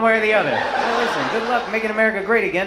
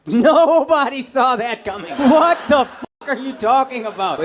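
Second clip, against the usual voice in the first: mock outrage; 4 semitones higher, 4 dB louder, slower than usual. Real, not acted. Crowd work, mock outrage.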